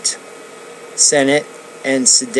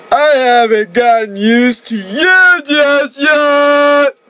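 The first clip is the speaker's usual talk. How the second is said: higher and louder, smiling flirtation; smiling reply; 14 semitones higher, 5 dB louder than usual. Acted. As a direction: say it with a smile, tenderly teasing. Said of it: "he answered, smiling".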